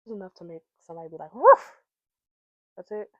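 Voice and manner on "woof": sudden loud, high woof; short, explosive imitation bark; dog bark. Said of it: "Why we barking now?".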